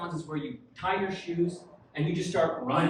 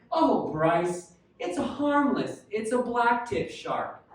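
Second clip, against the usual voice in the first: higher, slow, soothing mom voice; drawn-out, higher-pitched reassurance; mother-in-law impression; 5 semitones higher, much slower than usual. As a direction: imitate a mom gently correcting. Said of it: "Her mom's like".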